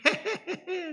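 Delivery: slow, silly hehehe giggle; mock giggle. Preacher mocking those who only giggle when things go well, silly giggle. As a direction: giggle mockingly at good fortune. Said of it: "헤헤헤헤 거리고".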